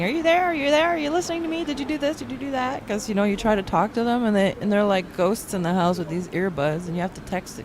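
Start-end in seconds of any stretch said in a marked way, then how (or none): 0.0-2.9 higher, pleading parent checking in; mock needy parent texting her teen; nagging parent. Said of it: "I'm, like, texting".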